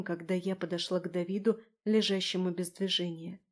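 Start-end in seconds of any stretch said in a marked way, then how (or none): none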